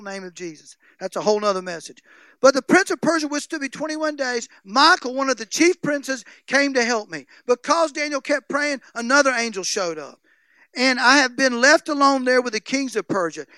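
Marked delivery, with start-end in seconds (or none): 2.4-13.5 loud, raised preaching read; fervent scripture reading; preached scripture reading. Acted. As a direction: proclaim the verses to the church. Reading scripture at full force.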